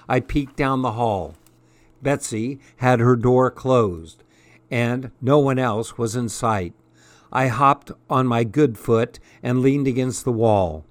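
ordinary delivery, nothing marked

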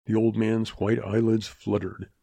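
usual speaking voice

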